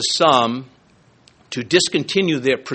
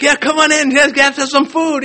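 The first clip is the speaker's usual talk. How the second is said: much higher, bright, eager mock host; eager welcome; 12 semitones higher, 5 dB louder than usual. Preacher imitating hospitable people welcoming guests, much higher and eager. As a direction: throw the door open and beckon them in. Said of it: "others are like".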